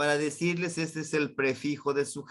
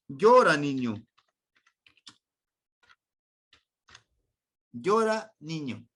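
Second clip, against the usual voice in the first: louder, commanding "llora, niño"; firm command; 5 dB louder than usual. Shown as a demonstration. Teacher acts out ordering a child to cry, firmly. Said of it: "Le estoy dando una orden que llore".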